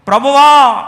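loud, high desperate call; crying out. Acted. Moses pleading for Israel, loud desperate cry. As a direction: cry out to God. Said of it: "అని కేకలు వేసి మొరపెడుతూ".

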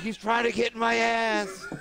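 quoting in a squeaky high voice; high squeaky quote. Retold or imitated. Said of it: "he's like".

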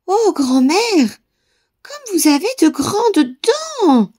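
put-on high, very slow awed girl voice; a scared little girl gasping; frightened girl breathing it out. A narrator's reading. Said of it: "souffla la petite fille". (fillette effrayée, voix aiguë et lente)

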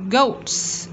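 GOATS ending in a hissed S; final S sound. Shown as a demonstration. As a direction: finish "Goats" on a clear S. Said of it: "S ending sound".

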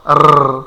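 আর ending in a long engine-like trill; buzzing, held tongue trill on আর; faulty over-rolled r. Shown as a demonstration. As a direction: rattle the r of আর. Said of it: "গাড়ি চালান হবে".

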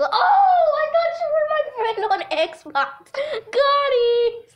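normal talking voice

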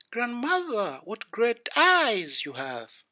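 put-on high, slow child's voice; wondering little girl. A narrator's reading. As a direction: marvel at granny, high and drawn out. Girl wonders at changed grandmother.

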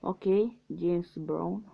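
ordinary talk, nothing marked

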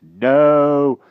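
high, loud bumbling knight; blustering old knight. Acted. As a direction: bluster like a dotty old king. Pellinore voice.

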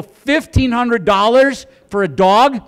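loud, high, drawn-out disbelief; incredulous outburst. Retold or imitated. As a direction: balk at an outrageous price. Husband balks at the price.

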